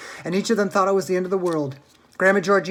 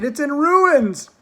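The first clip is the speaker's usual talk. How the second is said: higher, louder, slow wail; dismayed cry; 10 semitones higher, 9 dB louder, much slower than usual. A narrator's reading. Mister Bucket crying over his ruined house, higher and louder. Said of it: "cried poor Mister Bucket".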